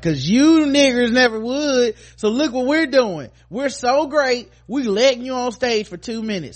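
high, loud mocking voice; sarcastic taunting. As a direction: taunt them like you're doing them a huge favor. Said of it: "this is almost like sarcastic taunting".

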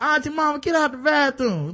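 lightly voiced high plea; mock complaint. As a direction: pitch up and complain. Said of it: "you be like".

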